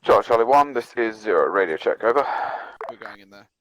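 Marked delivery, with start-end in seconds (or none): 0.0-2.3 clipped radio call, loud and formal; crackly military radio. Real, not acted. Radio check in milsim game.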